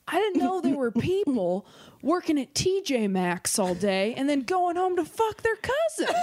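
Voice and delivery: slow, deliberate stage delivery rising in pitch; impression of a comic. Comic performing impression for the others, slow stage punchline voice. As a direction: draw out the set-up, lift the punchline. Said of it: "That's my impression".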